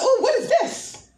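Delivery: high, excited discovery; excited ooh. Retold or imitated. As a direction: squeal it like finding a treasure. Excited tenant seeing appliances.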